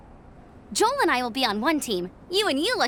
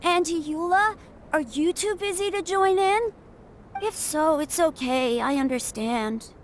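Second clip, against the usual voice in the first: put-on a shy little boy, trailing off; young boy voice.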